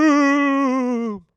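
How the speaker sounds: a weak ghostly woo, high and loud. Shown as a demonstration. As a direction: hoot like a half-hearted ghost. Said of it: "that's my weak ghost impression".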